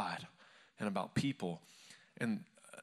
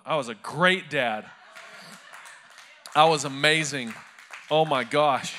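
louder, hammed-up self-praise; tongue-in-cheek pride; mock boast; 8 dB louder than usual. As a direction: brag playfully about being a great dad. Mock boasting about perfect parenting.

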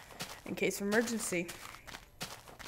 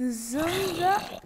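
usual speaking voice

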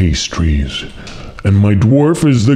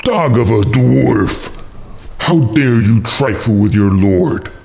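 angry cry. A narrator's reading. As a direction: scold the servant as an outraged lord. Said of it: "frowned with anger and cried".

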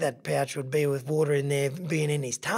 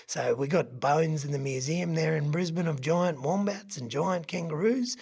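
ordinary delivery

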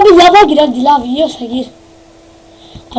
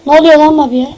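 ordinary talk, nothing marked

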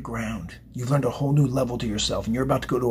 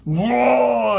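drawn-out, higher-pitched Hulk-style whoa; mock Hulk outburst, pitched up; Hulk roar; 9 semitones higher than usual. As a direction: let out a long Hulk "whoa". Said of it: "kinda like Hulk style".

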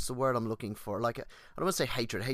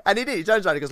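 excited; 10 semitones higher, 12 dB louder, much faster than usual. Real, not acted. Excited retelling of lecturer.

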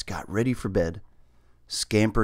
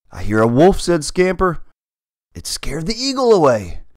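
scared; 7 semitones higher, 6 dB louder than usual. Acted. Scared cat hears wolf.

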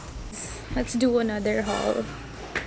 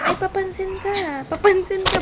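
high teasing pet voice; 8 semitones higher than usual. Real, not acted. Teasing the dog Bruce.

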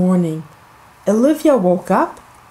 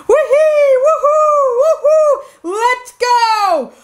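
gleeful squeal. Acted. Kids sledding down the hill, squealing with joy.

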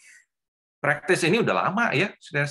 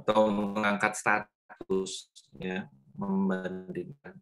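choppy dropping-out connection audio; breaking up space radio; slower than usual. Real, not acted. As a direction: voice cutting in and out. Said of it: "putus, Pak".

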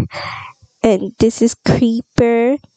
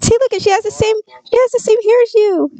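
much higher and quick, delighted; excited; 11 semitones higher, faster than usual. Real, not acted. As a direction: point out the likeness with glee. Excited showing the baby.

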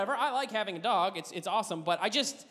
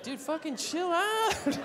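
slow, unbothered drawl; a cat too cool to care; cat telling the dog to relax; 6 semitones higher, slower than usual. Acted. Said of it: "The cat's just like".

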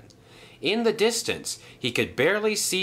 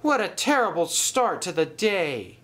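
high-pitched lament; calling out; 8 semitones higher than usual. A narrator's reading. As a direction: complain aloud in dismay. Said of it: "called Paxton".

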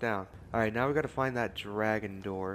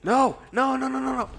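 very high, loud despairing scream; 11 dB louder than usual. Real, not acted. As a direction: scream in dismay. Puzzle fails, screams.

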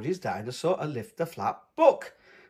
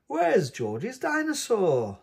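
sigh; 7 semitones higher, slower than usual. A narrator's reading. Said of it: "Peppa sighs".

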